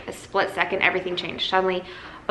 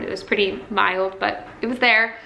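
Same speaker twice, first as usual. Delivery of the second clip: usual speaking voice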